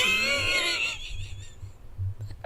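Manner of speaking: very high, squealing laugh; real high-pitched laughing shriek. Real, not acted. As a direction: let the laugh break up into a squeal. Laughs at her own cracking voice.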